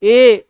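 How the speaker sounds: ए held out loud from the throat; demonstrated vowel. Shown as a demonstration. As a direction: sound ए long and full from the throat. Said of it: "कंठ से आवाज हो रही है".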